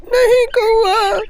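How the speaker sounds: high, slow and crying; grieving and tearful. Acted. Grieving Noni bird refuses the crow's offer of food, crying. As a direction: refuse through tears.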